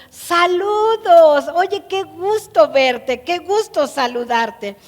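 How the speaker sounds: high, slow, loud glad greeting; delighted welcome. Acted. King welcoming the lame prince, delighted and loud. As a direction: open your arms with your voice. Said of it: "entre admiración".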